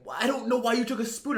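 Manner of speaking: high-pitched imitation of an upset mom; yelling. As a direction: act out a mother's agitated scolding. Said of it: "like, yelling at me".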